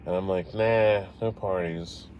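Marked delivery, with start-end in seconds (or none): none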